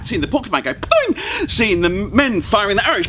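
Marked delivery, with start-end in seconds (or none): 0.7-1.5 very high springy boing; boing noise. Acted. The bat remembers the porcupine, springy high boing. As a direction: bounce it up like a spring.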